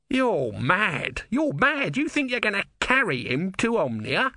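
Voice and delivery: high squeaky outrage at a foolish plan; incredulous squeaky tortoise god. A narrator's reading. Om scoffing at Brother carrying Vorbis, high incredulous squeak. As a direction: scoff like a tiny furious god.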